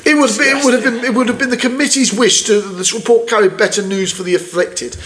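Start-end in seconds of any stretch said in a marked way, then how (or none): none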